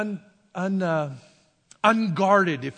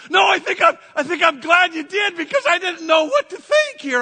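excited answer; 8 semitones higher, 5 dB louder than usual. Retold or imitated. Answering wife after waking.

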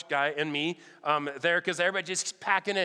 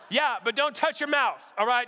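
calling out; 7 semitones higher, 4 dB louder than usual. Retold or imitated. Wife calling out.

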